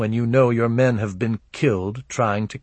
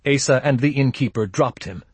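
ordinary delivery, nothing marked

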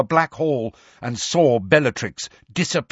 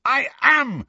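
high, defiant cry; impassioned, high-pitched yell; shouted; 12 semitones higher than usual. A narrator's reading. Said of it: "shouted Harry".

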